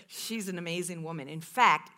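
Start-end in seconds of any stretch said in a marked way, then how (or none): none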